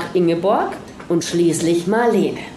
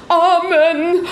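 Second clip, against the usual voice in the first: desperate amen; 9 semitones higher than usual. A narrator's reading. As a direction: gasp out the amen. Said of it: "betete in einem fort". (hohes, ängstliches Amen)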